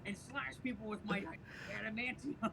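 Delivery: Danny DeVito. Acted. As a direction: play the trash man as Wolverine, loud and high. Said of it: "really good Danny DeVito impression right there".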